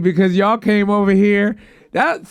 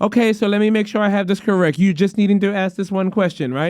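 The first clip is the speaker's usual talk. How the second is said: put-on Indian-accented agent confirming by rote; imitated Indian accent. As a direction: read back politely in an Indian accent. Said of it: "Pardon the accent".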